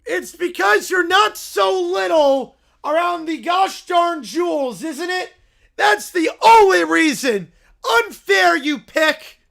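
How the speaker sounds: mock him through laughter; laughing taunt. Bear taunting rival in a quarrel.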